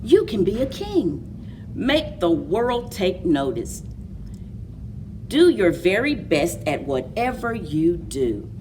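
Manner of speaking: plain reading voice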